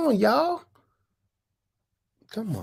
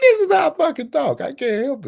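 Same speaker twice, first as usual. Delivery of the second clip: much higher and louder, laughing through it; 12 semitones higher, 7 dB louder than usual. Real, not acted. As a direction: burst out laughing while insisting. Insisting this is his voice.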